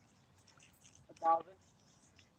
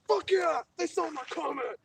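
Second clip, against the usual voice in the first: jazzed; 2 semitones higher, 11 dB louder, slower than usual. Retold or imitated. Viewer reliving his comment being read on a live stream, jazzed. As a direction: re-enact a thrilled cheer at being noticed. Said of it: "Gets me all jazzed".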